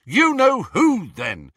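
high, loud, drawn-out shout, falling at the end; fed-up yell; exasperated shout. A narrator's reading. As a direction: shout it, goaded past endurance. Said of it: "Harry shouted".

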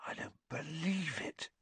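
hushed, high hoarse disbelief. A narrator's reading. Ron seeing the broomstick, hoarse and stunned. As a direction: say it hoarsely, barely voiced, in awe. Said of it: "he said hoarsely".

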